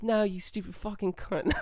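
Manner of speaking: high mock Aussie comeback; heated Australian accent. Firing back at the girls, heated fake Australian accent. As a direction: fake Aussie insult on the edge of laughter. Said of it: "turned into a little bit of an argument".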